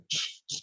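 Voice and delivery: quick repeated shushing; unvoiced "shh" to silence someone; hushing. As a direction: shush them softly and secretively. Hushing the others about the women.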